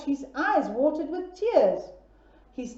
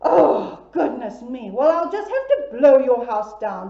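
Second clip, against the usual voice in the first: wolf threat; 9 dB louder than usual. Acted. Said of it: "said the big bad wolf".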